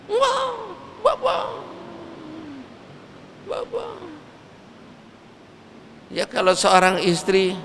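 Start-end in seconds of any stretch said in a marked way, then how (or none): 0.1-3.9 high, fading cries of bapak; mourning wife calling her husband; wailing widow. Acted. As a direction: sob his name out, dying away. Widow keening over dead husband.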